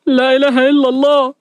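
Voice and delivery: intoned, dread-filled response; comic, resigned fright; very fearful. A narrator's reading. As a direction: chant it like facing doom. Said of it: "وسليم بخوف شديد".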